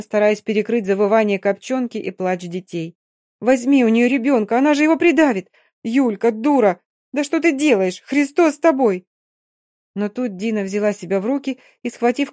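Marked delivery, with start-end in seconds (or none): 3.4-9.1 urgent shouting. Acted. Shouting over screaming children.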